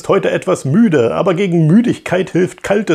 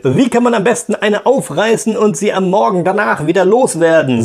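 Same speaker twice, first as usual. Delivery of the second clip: macho viewer; 5 semitones higher than usual. Acted. A viewer asking about pickups in a higher cocky put-on voice. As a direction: ask it like a cocky guy.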